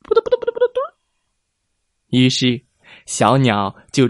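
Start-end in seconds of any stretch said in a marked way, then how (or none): 0.0-0.8 high flapping sound. Acted. Bird flapping wings.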